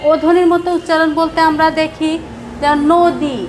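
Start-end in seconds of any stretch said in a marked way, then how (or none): 2.9-3.4 নদী said as "nodi", its অ sounding like ও; নদী, অ ও-এর মতো; o-like vowel. Shown as a demonstration. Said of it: "এখানে ও এর মতো শোনা যাচ্ছে".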